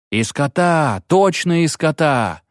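a mob shouting agreement; peasants shouting. Acted. Peasants back the priest, shouting. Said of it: "закричали кметы".

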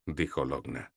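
ordinary delivery, nothing marked

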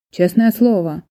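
ordinary delivery

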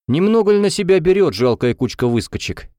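high, scornful comeback; snarky retort. A narrator's reading. Middle student to the defenders, snapping. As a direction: snap back with contempt. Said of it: "огрызнулся центральный".

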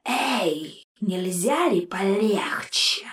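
slow, soft, hissed whisper; rattled skull hissing; hissing ghost. A narrator's reading. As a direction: hiss the complaint through the glass. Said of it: "прошипел призрак".

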